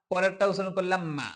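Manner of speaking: ordinary delivery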